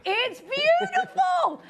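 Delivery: high, loud, stretched-out exclamation; hammed-up shout. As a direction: gush over the pendant in a drawn-out shout. Host hams up praise of pendant.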